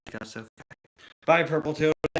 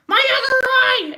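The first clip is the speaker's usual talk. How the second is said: Mrs. Potato Head voice; 9 dB louder than usual. Retold or imitated. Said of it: "Missus Potato Head".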